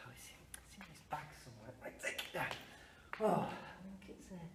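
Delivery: whispered fast aside; muttering to himself. Real, not acted. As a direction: mutter while searching a bag. Rummaging for map aside.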